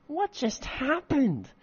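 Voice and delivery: high, slow whiny question. Acted. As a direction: whine it like a petulant kid. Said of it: "in a pettish, whiny voice".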